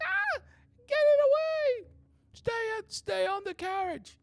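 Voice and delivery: very high, panicked woman's scream; screaming young woman. Acted. Game master voices a young woman attacked on a carriage, high panicked scream. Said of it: "like a young woman".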